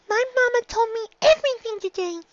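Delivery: put-on a young filly asking earnestly; little filly voice. A narrator's reading. Filly Dinky answers.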